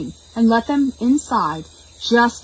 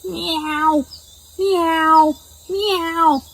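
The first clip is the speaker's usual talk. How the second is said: high meowing cat sound; silly high meow; horses meowing; 9 semitones higher, much slower than usual. Acted. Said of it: "opened their mouths wide and".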